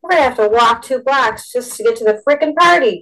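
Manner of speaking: whiny moan of protest. A narrator's reading. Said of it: "Joe moaned".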